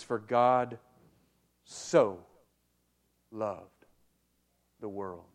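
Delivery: "For God / so / loved / the world" with a long pause before and after "so"; slow emphatic reading. Shown as a demonstration. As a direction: lean on "so" and let each word stand alone. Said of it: "I want us to draw attention to the word "so".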